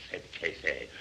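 ordinary delivery, nothing marked

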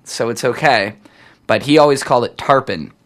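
ordinary talk

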